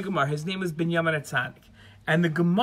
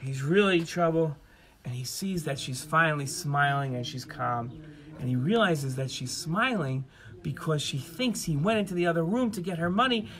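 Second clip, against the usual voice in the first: plain reading voice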